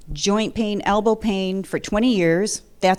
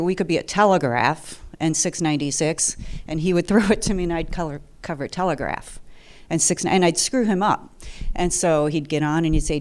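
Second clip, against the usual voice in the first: ordinary delivery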